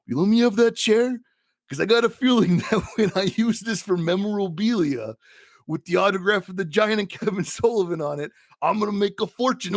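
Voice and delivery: high, strained wrestler promo voice; Macho Man. Retold or imitated. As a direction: pitch it high like a scheming hammy wrestler. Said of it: "which is he just goes".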